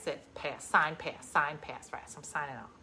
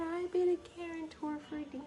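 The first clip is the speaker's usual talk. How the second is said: put-on baby talk to dog; 11 semitones higher, 6 dB quieter than usual. Voicing dog Ruby.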